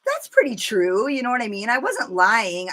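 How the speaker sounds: ordinary talk, nothing marked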